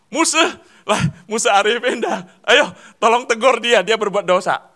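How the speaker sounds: high, loud laughing call for help; mock pleading for someone else to rebuke; calling on Musa Arifin. Churchgoer pushing the job onto another pastor, high mock call.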